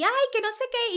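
squeaky high mock protest; a quick high parody of a shrill interruption; mock squabbling. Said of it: "en el que gritaban".

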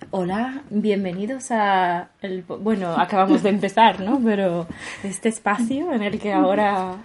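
slow, soft, drawn-out host voice; mock podcast host tone. Host imitating other podcasters, slow soft mock podcast tone. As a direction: mimic a calm podcaster easing listeners in, lightly. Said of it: "hablar con tono de podcast".

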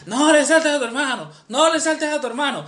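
high-pitched motherly warning; scolding mother. Retold or imitated. Mother warns child not to jump over brother, scolding. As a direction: imitate a mum nagging her child.

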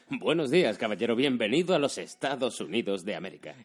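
hammed-up dubbed-American Spanish, loud greeting; Spanish dubbing accent. Airport man welcoming a traveller to America, in Spanish dubbing accent. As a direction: parody a Spanish film dub's grand welcome. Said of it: "el acento del doblador español".

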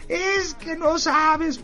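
high, slow, wailing mock sob; hammed-up blubbering plea; mock sobbing. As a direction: wail it like a heartbroken woman at a food stall. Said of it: "pero berreando la señora horrible".

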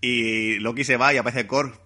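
ordinary talk, nothing marked

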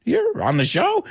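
playfully outraged, voice climbing high; are you kidding me; incredulous. Retold or imitated. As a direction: pitch shooting up in mock disbelief. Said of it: "And I was like".

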